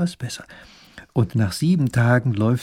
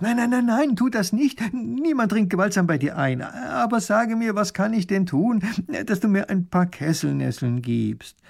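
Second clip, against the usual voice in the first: high-pitched panicked pleading; aghast little duke, voice shot up; horrified; 9 semitones higher than usual. A narrator's reading. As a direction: cry out in alarm, then plead. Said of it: "ruft ganz entsetzt".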